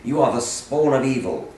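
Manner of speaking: loud, mock-indignant reading of hate mail aloud. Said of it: "read some of your hate mail".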